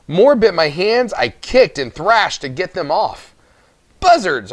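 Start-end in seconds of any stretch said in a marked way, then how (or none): 4.0-4.5 loud, higher startled yell; scream. A narrator's reading. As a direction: yell it out while the pixies bite. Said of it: "I screamed".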